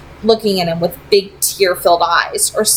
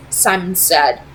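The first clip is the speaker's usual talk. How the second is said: plain reading voice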